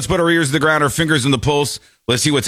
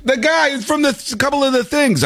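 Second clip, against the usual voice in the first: much higher, a hint of a clueless fan; vague guy-naming. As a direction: mimic someone who can't recall the name. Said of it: "I go".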